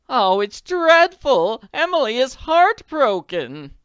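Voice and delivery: fretful lady lamenting; distressed lament. Acted.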